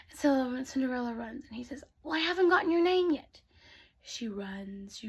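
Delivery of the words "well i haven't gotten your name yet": high, fast call after her. Acted. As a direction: shout it as she runs off. Prince calling after her.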